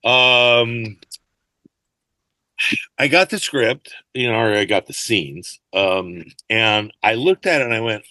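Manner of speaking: ordinary talk, nothing marked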